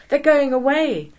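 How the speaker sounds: high, loud excited cry; cried out. A narrator's reading. Boys calling out as the flock veers, excited. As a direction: boys crying out as birds veer off. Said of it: "the boys cried".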